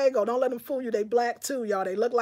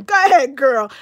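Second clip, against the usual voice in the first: excited cheer; 11 dB louder than usual. Real, not acted. Streamer cheering a viewer's bold compliment, loud excited whoop. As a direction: hype her up, loud and bright.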